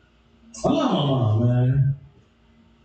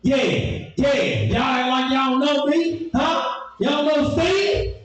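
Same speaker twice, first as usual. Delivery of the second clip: put-on mad boyfriend; 10 semitones higher than usual. Angry boyfriend challenging the kids at the car, indignant. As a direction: demand it again and again, offended.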